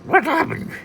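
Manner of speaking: high dazed duck squawk; Donald Duck voice. Acted. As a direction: squawk it after a tumble. Donald falls down.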